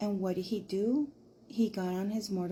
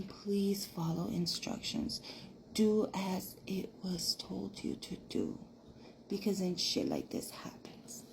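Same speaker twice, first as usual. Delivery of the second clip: eerie whisper; 6 dB quieter than usual. Acted. Storyteller warning viewers to follow instructions, whispered. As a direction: warn them in a spooky whisper.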